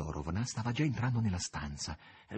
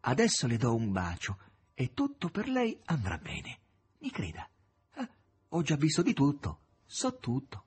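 higher, soothing woman's voice; Margherita comforting; 5 semitones higher, 3 dB louder than usual. Acted. Margherita promises Ivan all will be well, gently higher. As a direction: reassure him before a kiss.

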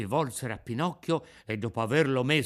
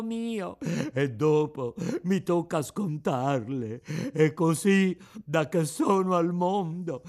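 high, tearful puppet voice pleading with his friend; a boy sobbing over his hurt friend; weeping lament; 5 semitones higher, 3 dB louder than usual. A narrator's reading. Pinocchio begs fallen Eugenio to wake, tearful. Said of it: "piangendo dirottamente, disperandosi".

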